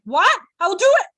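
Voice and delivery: high, loud mock eagerness; shrill-free high excited mock grab; eager. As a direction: leap at the offer, hammed-up. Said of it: "So we're like".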